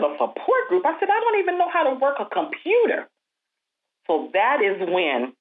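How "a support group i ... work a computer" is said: high, disbelieving exclamation; answering God, high and amazed; incredulous. Retold or imitated. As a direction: throw the pitch way up in disbelief. Retold disbelief at God's call.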